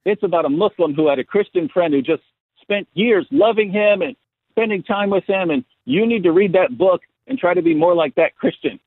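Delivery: lightly mimicked know-it-all giving advice; preachy critic. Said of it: "the guy says".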